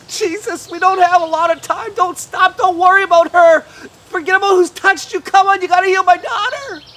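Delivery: high, loud, desperate hurry; frantic urging. A narrator's reading. Father pleads with Jesus to keep going, loud and frantic. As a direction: beg him not to stop. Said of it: "Jairus is thinking".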